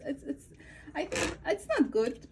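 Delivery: ordinary talk, nothing marked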